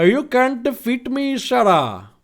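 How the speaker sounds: put-on high-pitched Indian-accented taunt; Abraham Lincoln, Indian accent. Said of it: "Abraham Lincoln with an Indian accent".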